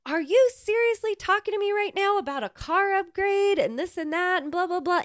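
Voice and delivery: high, sharp mock yell of an angry clerk; retold yelling. Rental clerk yelling at rude customers, mock angry. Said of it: "just starts yelling at them".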